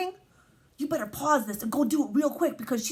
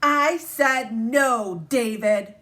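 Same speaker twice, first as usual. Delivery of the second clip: very slow, louder, each word weighted; firm mom; 7 dB louder, much slower than usual. Acted. Teacher reading the mom's final no, slow loud and firm. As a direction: lay down the law, slow and loud.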